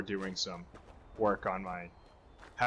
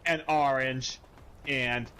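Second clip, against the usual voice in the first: ordinary talk, nothing marked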